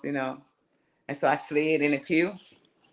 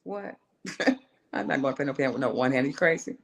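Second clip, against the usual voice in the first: ordinary talk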